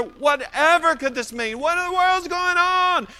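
high-pitched bewildered outcry; amazed and perplexed. Acted. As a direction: cry it out in mounting bafflement. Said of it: "they were all amazed and perplexed".

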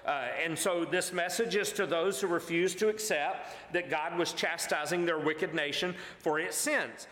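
normal talking voice

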